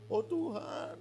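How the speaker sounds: high, pleading whine to God; mock whiny prayer. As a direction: ham up a panicked complaint. (rengekan doa yang dibuat-buat, nada tinggi)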